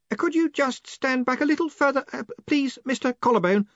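high, hesitant wizard voice; a nervous assistant stammering; flustered young wizard. A narrator's reading. Ponder character voice.

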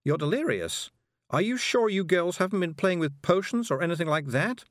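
high, anxious questioning; Miss Level, concerned. A narrator's reading. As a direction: voice a worried woman up high. Miss Level, alarmed, in story.

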